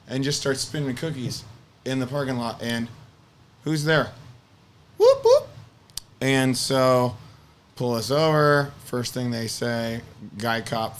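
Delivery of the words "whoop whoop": high, loud siren whoops; two piercingly high mock siren bursts; imitated police siren. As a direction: mimic a squad car's whoop. Imitates police siren behind them.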